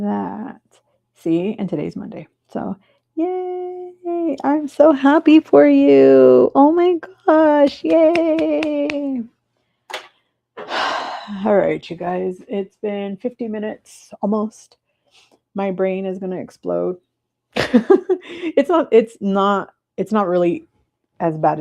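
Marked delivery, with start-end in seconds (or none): 3.2-9.2 high, loud, drawn-out gushing; squealing with delight; excited cheer. Real, not acted. Cheering Keisha on again. 17.5-18.2 high laugh. Real, not acted. Joking brain will explode.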